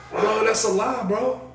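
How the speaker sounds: high-pitched, insistent protest; real indignant disbelief. Real, not acted. Disputing the agent's phone claim, high indignant protest. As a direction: protest it as an outright lie.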